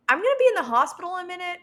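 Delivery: high, loud and fast objection; exasperated protest. Retold or imitated. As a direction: rattle it off in disbelief. Protesting to firemen.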